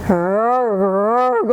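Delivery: two short growls from the throat; bear growl. Acted. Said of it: "Old Bear growled".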